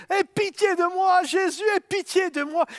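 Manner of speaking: high, loud cry for mercy; desperate cry. Acted. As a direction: beg at the top of your voice. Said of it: "il crie".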